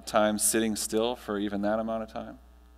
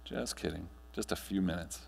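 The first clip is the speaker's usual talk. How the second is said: guided meditation; 3 semitones lower than usual. Acted. Speaker guiding the audience through breathing, calm meditation voice. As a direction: ease the room gently into stillness.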